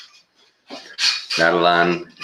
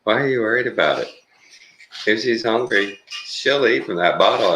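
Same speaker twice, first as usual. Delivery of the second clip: retold own reassurance; 7 semitones higher than usual. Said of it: "And I said".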